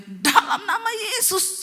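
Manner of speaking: very high, drawn-out cry; shouted breakthrough. Retold or imitated. Husband finally shouts the holy name, high drawn-out cry. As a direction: cry it out slowly, high-pitched. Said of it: "suaminya teriak".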